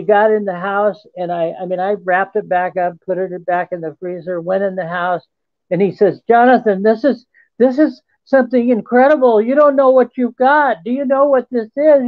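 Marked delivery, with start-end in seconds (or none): 6.3-12.1 higher, faster, rising amazement; a stunned friend who can't believe his eyes; excited friend. Retold or imitated. Friend blurting out amazement over the alien body, excited and higher.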